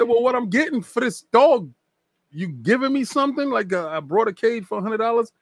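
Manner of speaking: high, indignant asking; incredulous haggling. Retold or imitated. Retelling to the hosts how he pushed the shop guy for a freebie, high and indignant. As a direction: pitch it up like you can't believe there's no freebie.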